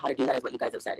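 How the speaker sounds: ordinary talk, nothing marked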